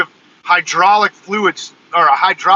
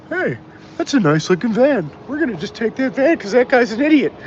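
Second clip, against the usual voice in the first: higher, jeering voice of a local thief; mock local; 7 semitones higher than usual. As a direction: play a smug local mocking the idiot owner. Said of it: "the locals could just say".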